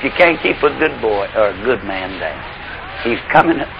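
quiet low preacher's aside; soft and low. Real, not acted. Preacher drops after climax.

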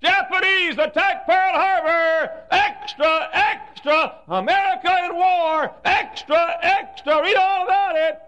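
high, loud, slow newsboy cry; newsboy shouting. Retold or imitated. Newsboy calling the war headlines to the street, loud. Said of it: "They'd come down the street".

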